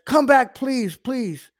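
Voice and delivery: high, begging plea, hammed up; mock pleading. Preacher mocks a Jesus who begs the rich man back, high pleading. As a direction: beg him to come back, mockingly.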